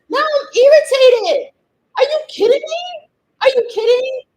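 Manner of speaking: real irritated outburst. Real, not acted. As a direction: let the frustration burst out. Frustrated about labels.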